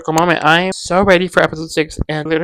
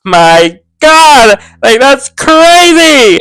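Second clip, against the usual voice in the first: excited shriek; 6 semitones higher, 11 dB louder, much slower than usual. Retold or imitated. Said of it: "I was like".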